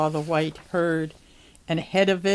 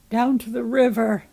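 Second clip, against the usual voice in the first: plain reading voice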